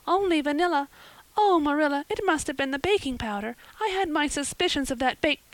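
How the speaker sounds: put-on high-pitched, flustered girl; mortified. A narrator's reading. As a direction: protest in high, mortified distress. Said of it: "her face scarlet with mortification".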